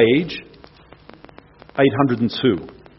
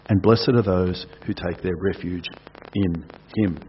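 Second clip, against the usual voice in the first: hushed scripture reading; 7 semitones lower, 4 dB quieter than usual. Acted. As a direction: let the warning sink in quietly. Closing of psalm reading.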